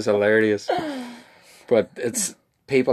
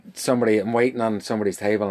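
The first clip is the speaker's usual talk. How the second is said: ordinary talk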